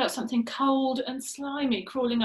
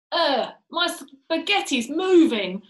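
slow disgusted groan; 5 semitones higher, 7 dB louder, slower than usual. Acted. Mister Twit disgusted at food.

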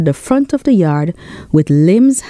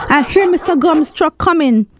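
high, urgent warning; nervous shout; 10 semitones higher than usual. Acted. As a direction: warn the boys that the truck is coming. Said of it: "Tony shouted nervously".

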